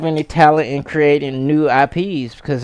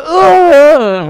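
mock grunt; 11 semitones higher, 6 dB louder than usual. Imitating Ranger being hit.

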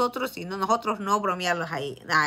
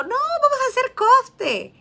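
much higher, slow put-on voice; mock high voice; 7 dB louder than usual. To her dad on the phone, a high slow mock voice.

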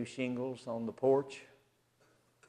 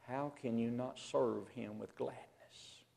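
whispered plea; 11 dB quieter than usual. Real, not acted. Preacher drops to whisper.

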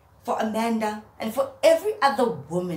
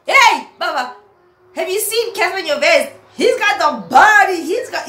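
much higher and louder, hyped up; mock excited call; 10 semitones higher, 11 dB louder than usual. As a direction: call out to a buddy, all hyped.

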